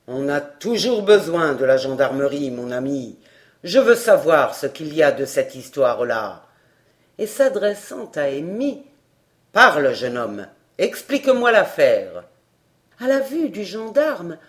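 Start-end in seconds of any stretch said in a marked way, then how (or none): 0.1-6.4 much lower, louder official demand; stern gendarme. A narrator's reading. Gendarme to the crowd, lower, louder and stern. 9.5-12.2 much lower, louder official demand; stern gendarme. A narrator's reading. Gendarme to the crowd, lower, louder and stern.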